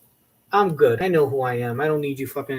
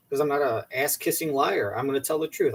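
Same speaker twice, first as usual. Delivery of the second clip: ordinary delivery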